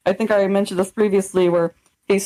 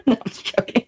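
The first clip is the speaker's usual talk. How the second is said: normal talking voice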